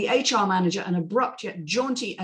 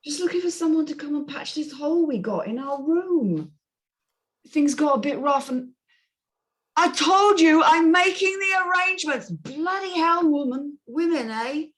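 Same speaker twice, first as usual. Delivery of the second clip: put-on a higher, slurring drunk Brit on the phone; drunk Jason Statham; 8 semitones higher than usual. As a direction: barrel through like a tipsy hotel guest. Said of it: "a voice like a drunk Jason Statham".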